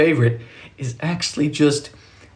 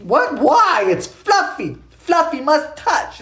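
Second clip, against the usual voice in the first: high, baffled little voice; mock childlike protest; 10 semitones higher, much slower than usual. Protesting to dad about fiberglass, high mock bafflement. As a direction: plead like a kid, hammed up.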